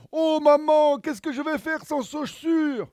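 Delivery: put-on high, loud child complaint; whiny little girl. Juliette wails to mother.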